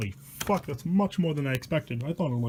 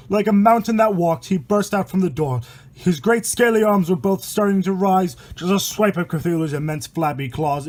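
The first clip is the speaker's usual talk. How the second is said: steady rhythmic verse read aloud; poem reading; 5 dB louder than usual. A narrator's reading. Reading a rhyming horror poem to viewers, rhythmic storytelling.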